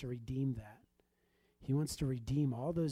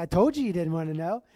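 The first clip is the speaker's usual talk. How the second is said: much louder, a teasing told-you-so; 4 semitones higher, 12 dB louder than usual. Retold or imitated. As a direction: throw it back at her loudly. Told you so, she laughs.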